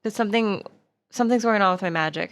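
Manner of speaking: ordinary talk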